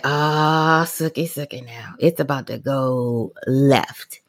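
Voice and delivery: low, slow knowing warning. Retold or imitated. Mom sensing the visit is about to go wrong, low and slow. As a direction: drop low like trouble is brewing.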